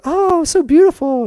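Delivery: high, slow mock swoon; mock admiring. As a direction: coo it like you expect praise. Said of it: "you're like".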